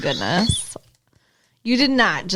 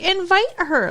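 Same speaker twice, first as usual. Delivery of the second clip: high-pitched, exasperated mock yell; retold yelling at TV; 9 semitones higher than usual. Viewer yelling advice at the TV character, mock yell. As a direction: pitch it up like scolding the screen. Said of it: "I was yelling at the T V".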